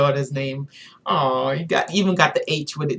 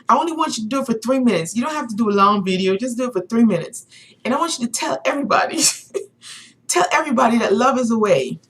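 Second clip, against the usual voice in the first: fast, high-pitched urging; mock impassioned, quick and pitched up; imitating passionate man; 7 semitones higher, faster than usual. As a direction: act out his eager plea. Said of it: "he was so passionate about what he was talking about".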